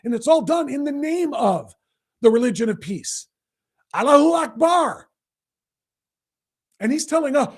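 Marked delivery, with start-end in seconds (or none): 3.9-5.1 higher imitation of a zealot's cry; put-on attacker's cry, pitched up; mock battle cry. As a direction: call it out as a mimicked war cry. Mimics attackers' cry in angry rant.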